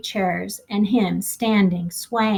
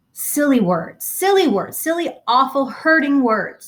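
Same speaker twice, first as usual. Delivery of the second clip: upset repeating; 6 semitones higher, 4 dB louder than usual. Acted.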